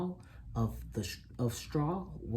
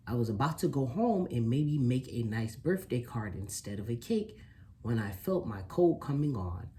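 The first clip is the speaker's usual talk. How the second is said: usual speaking voice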